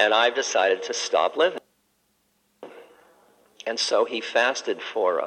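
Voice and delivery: normal talking voice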